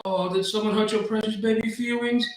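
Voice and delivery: high-pitched mock-sympathy taunt; mocking lady. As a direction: coo it high, as if pitying a whiner. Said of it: "this lady goes".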